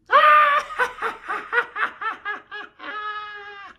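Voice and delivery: long, high, shouted cackle; loud villain laughing fit; maniacal Joker laugh. Acted. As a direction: cackle like a madman. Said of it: "more of the Mark Hamill thing".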